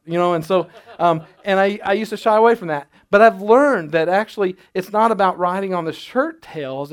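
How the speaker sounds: normal talking voice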